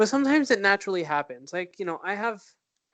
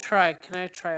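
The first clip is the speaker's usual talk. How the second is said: usual speaking voice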